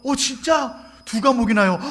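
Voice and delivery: loud, high amazed "really?"; surprised reply. Retold or imitated. Pastor hearing the child's perfect score, loud and amazed. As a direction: react in delighted disbelief.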